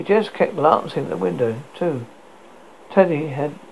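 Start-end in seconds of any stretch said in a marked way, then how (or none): none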